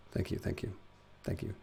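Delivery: breathy whispered thank you. Real, not acted. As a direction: whisper it to chat.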